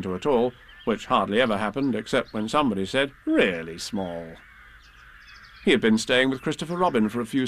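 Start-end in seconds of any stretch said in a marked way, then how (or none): 3.2-4.4 slow, low and drawn out; drawn-out quote. A narrator's reading. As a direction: stretch it out slowly, dropping low. Quoting what somebody said.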